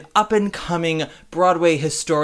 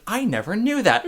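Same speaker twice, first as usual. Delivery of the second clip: higher-pitched delighted surprise, retold; thrilled retold reaction; 8 semitones higher than usual. Host retelling a chat with new friend, thrilled. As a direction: imitate your own thrill at learning something. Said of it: "and I was thrilled".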